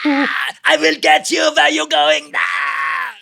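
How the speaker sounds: high, loud hammed-up death scream; shouting death scene for a dub; dying yell. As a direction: ham up a big movie death, full volume. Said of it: "volunteered for every death scene".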